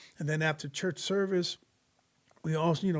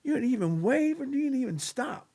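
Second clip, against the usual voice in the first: put-on higher, indignant complaint of an old church lady; hurt accusation; 8 semitones higher than usual. Old church lady scolding the narrator who drove past her, indignant and higher. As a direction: voice an offended older woman, accusing. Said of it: "And she's like".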